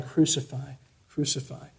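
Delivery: very soft, low "chant"; deliberately underplay the mob's cry, hushed; mock whispering. Shown as a demonstration. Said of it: "They weren't whispering like this".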